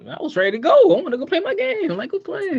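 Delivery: high-pitched mock whine; restless churchgoer. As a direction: plead to get away from a long sermon. Acts restless child stuck in church.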